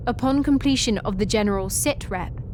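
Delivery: ordinary talk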